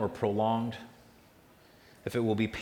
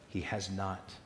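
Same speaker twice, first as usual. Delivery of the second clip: soft reassurance; 4 semitones lower, 9 dB quieter than usual. Real, not acted. Revealing a comforting secret.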